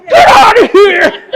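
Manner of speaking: high, loud, slow yell; shrieked order with laughter; shouting. Retold or imitated. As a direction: hurl it at him, half laughing. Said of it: "Yeah, I'm like".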